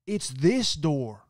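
loud, high, slow mock scold of a grumpy woman; disgruntled lady. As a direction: play the snippy guest telling him off. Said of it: "She goes".

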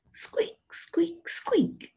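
tiny high squeaks; hamster squeak. Acted. Teacher repeating what hamsters say for children, high squeaking imitation. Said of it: "What do they say?".

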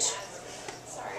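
soft whispered sorry; embarrassed whispered sorry mid-poem; whispered aside. Real, not acted. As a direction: drop to a whisper to apologise. Poet forgets her line.

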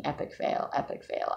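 whispered self-reproach; a whispered chant of failure; inner voice whisper. Retold or imitated. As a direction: whisper it like a nagging thought. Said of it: "in my brain, I was going to bed thinking".

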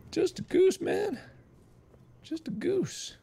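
high, soft little voice; meek plea. Pretend. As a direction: plead innocence in a tiny high voice. Pleading innocence as the goose.

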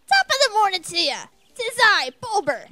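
loud, high, beaming cheery voice; hammed-up jolly flower. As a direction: belt it out like a giddy little bulb. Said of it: "I don't know why I said it like that".

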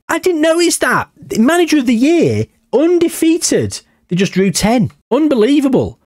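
high, excited squeals of disbelief; can't believe what's on screen; amazed disbelief. Real, not acted. Gamer to viewers, shocked by unbeaten record, high amazed voice.

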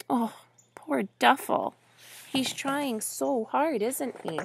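put-on soft, pitying aside to a child; tender sympathy. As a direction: feel sorry for him, softly. Reader pities Duffel to child.

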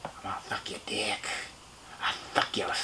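soft unvoiced leering whisper; whispered imitation of a leering stranger; whispered catcall. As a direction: hiss it creepily through the glass. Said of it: "like that".

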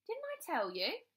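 high, innocent question; feigned innocence. A narrator's reading. Bradley to his mother about conference day, innocently. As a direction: play innocent, pitch floated up. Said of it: "he asked innocently".

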